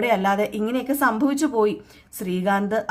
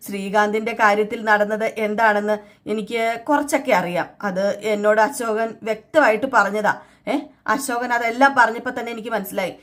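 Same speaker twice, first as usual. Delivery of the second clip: ordinary delivery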